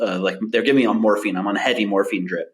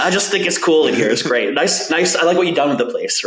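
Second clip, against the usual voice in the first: hammed-up cheerful joking; 5 semitones higher than usual. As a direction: play it like a cheeky patient charming the staff. Joking with surgical staff.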